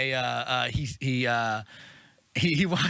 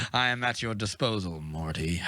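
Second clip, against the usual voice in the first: low, stately dragon voice, sinking at the end; a great beast pledging service; solemn dragon; 5 semitones lower than usual. Retold or imitated. Dragon offering service to the boy, solemnly.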